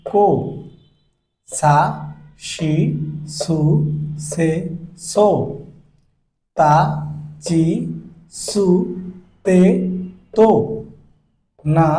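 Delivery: slow, even recital of the syllables. Shown as a demonstration. Teacher reciting the chart to students, slow and even. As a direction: call out each syllable for the class.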